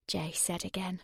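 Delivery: plain reading voice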